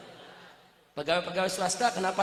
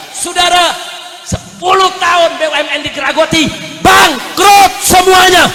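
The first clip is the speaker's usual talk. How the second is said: loud, high indignant sermon shouting; fiery rally-style preacher's shout; full-force preaching; 6 semitones higher, 18 dB louder than usual. Real, not acted. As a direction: thunder accusations at the congregation.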